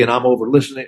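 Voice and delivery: usual speaking voice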